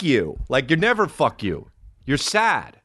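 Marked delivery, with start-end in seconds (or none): none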